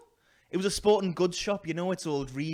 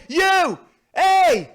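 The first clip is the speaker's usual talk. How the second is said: loud, high angry call across the street; shouting at him; 12 semitones higher, 11 dB louder than usual. Retold or imitated. Confronting the con man who owed him, shouting. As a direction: yell at the man who took your money.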